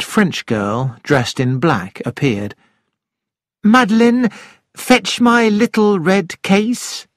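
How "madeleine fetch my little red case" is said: high, slow lady's command; a hint of a haughty aristocrat. A narrator's reading. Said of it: "said Lady Horbury".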